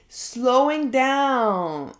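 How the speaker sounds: stretched very slowly, savoring each syllable; drawn-out. Real, not acted. Vlogger dwelling on her fear, very slow. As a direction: let the words crawl. Repeats the feared phrase.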